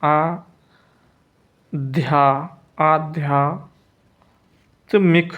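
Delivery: आध्यात्मिक in pieces, आ-ध्या-त्मिक, with long gaps; drawn-out spelling of आध्यात्मिक; slow dictation. Shown as a demonstration. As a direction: build आध्यात्मिक slowly part by part. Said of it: "आध्यात्मिक ऐसे लिखेंगे".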